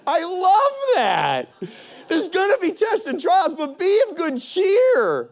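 excited preacher relishing good news; delighted exclamation. Real, not acted. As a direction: gush over it, pitched right up. Delighting in the quoted verse.

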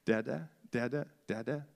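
lightly cooed dada, rising; baby talk coaxing. Retold or imitated. Dad coaxing his baby to say dada, lightly cooed. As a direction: coax a baby into saying it. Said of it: "I wanted my kids to say me first".

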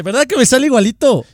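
high, eager retold question; fishing for praise. Lady asking if her [name] sounded right, high eager voice. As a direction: ask proudly for a compliment.

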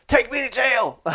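high, hammed-up plea; mock desperate cry. Overwhelmed narrator begging border agents to arrest him. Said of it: "I just want it to be over".

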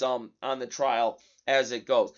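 usual speaking voice

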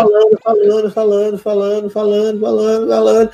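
high, repetitive mock chatter; endless droning. Imitating Mirella talking on and on, high relentless droning. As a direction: keep the word looping without a break.

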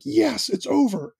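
high, slow triumphant cry; relieved kid cheer. Retold or imitated. Dad imitating his son cheering at intermission, high mock relief. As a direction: celebrate like the ordeal just ended. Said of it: "he jumps up and he's like".

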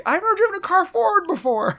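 high-pitched mock-panicked protest; imitated panic. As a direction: protest high, playing a panicked young driver. Retold panicked protest of driver.

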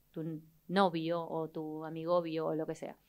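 usual speaking voice

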